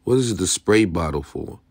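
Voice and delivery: usual speaking voice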